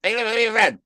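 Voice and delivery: fast voiceover. Pretend. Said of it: "A little too fast".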